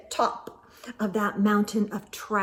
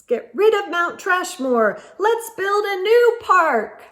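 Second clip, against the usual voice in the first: higher, louder call to action; rallying sign; 7 semitones higher, 5 dB louder than usual. Acted. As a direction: proudly announce the sign's message.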